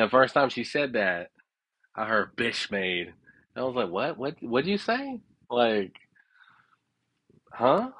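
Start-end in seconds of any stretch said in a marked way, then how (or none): 3.9-7.9 high, baffled what'd you say; confused what. Retold or imitated. Baffled asking the woman to repeat herself, high confused questioning. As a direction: squint at the words and ask again. Retelling confusion at odd phrase.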